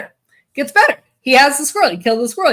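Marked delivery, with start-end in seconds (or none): 0.0-1.0 pitched up higher and louder; eager build-up before the best part; excited tease. Shown as a demonstration. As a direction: hype it up, bursting to tell the rest.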